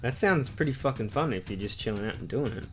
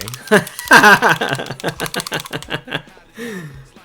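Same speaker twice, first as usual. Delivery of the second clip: loud burst of laughter; 4 semitones higher, 9 dB louder than usual. Real, not acted. Laughing at chat.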